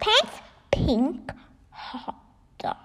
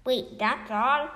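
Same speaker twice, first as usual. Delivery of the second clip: usual speaking voice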